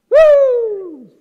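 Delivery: high, loud whoop of delight; excited whoop. Retold or imitated. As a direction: let out a joyful whoop. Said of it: "As he got excited".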